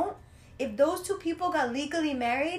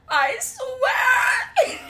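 high, loud laughing imitation of sobbing; mock hysterical crying; 11 semitones higher, 6 dB louder than usual. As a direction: ham up her hysterical wail while laughing. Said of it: "hysterically crying".

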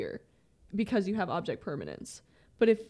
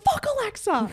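high, loud laughing curse at the speaker; mock yell at the speaker, cracking up; 13 semitones higher, 5 dB louder than usual. Retelling how she yells at Alexa, laughing.

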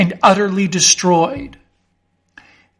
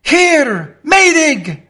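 high, loud, frantic calling; desperate scream; 9 semitones higher, 4 dB louder, much slower than usual. Acted. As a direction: yell for a friend over howling wind. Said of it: "screamed Mister Fotheringay's feebled voice".

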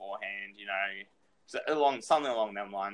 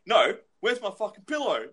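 higher, louder whiny retold yell; mock angry yell; 8 semitones higher, 6 dB louder than usual. Mate in the video yelling for his pillow, mock whiny yell. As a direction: shout it like the upset mate on video.

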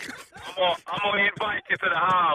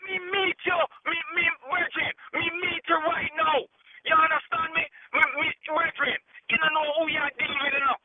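heated Jamaican patois, higher pitch; angry Jamaican patois; 5 semitones higher than usual. Real, not acted. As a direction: fire back in thick Jamaican patois. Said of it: "you went on a Jamaican run".

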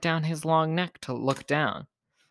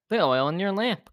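ordinary delivery

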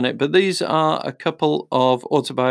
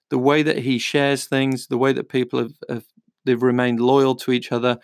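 normal talking voice

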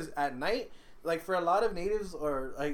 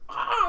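imitated owl screech; 6 dB louder than usual.

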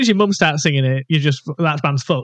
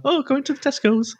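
higher, breezy mock mum; put-on mum voice; 8 semitones higher than usual. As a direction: chirp it like an unbothered mother. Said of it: "That's not how my mum speaks".

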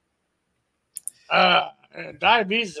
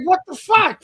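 shocked shout; 9 semitones higher, 6 dB louder than usual. Retold or imitated. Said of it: "I'm like".

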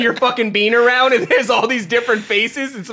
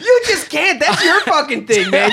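laughing tease; 6 semitones higher, slower than usual. Real, not acted. Teasing co-host, laughing.

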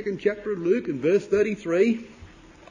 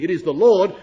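loud, emphatic announcement; firm, raised-voice declaration; 10 dB louder than usual. Retold or imitated. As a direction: state it forcefully, with no doubt. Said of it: "John said very emphatically".

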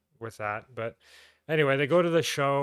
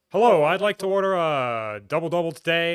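loud, higher mock newscaster delivery; news anchor; 6 semitones higher, 9 dB louder than usual. News anchor ordering at McDonald's, imitated broadcast voice. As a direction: order coffee as if opening a broadcast. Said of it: "a woman who's a news anchor".

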